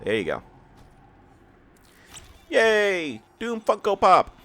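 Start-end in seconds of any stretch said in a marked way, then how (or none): 2.5-4.4 excited. Real, not acted. Unboxing a Funko Pop.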